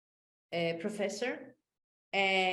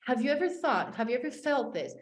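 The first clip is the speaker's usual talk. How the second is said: usual speaking voice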